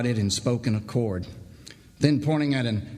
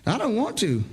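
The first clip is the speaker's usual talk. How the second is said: shaken saw filer; 9 semitones higher than usual. Acted. Bart refuses to go home.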